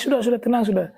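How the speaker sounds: calm him down like a worried elder; soothing comfort. Retold or imitated. Scholar comforts the crying tailor.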